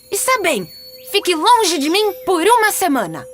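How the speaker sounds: high-pitched, still cross concession; grudging anger. Acted. Peter shortens Sininho's banishment to a week, grudging high voice.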